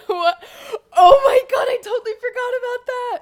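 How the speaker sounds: high, loud laughing squeal; laughing shriek. Real, not acted. Suddenly remembers an embarrassing video.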